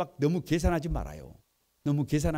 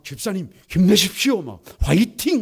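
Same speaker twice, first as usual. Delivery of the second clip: mock pastor cheering; 3 semitones higher, 6 dB louder than usual. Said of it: "막 이러고 댕겨요".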